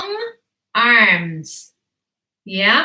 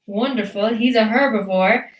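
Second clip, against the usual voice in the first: ordinary delivery, nothing marked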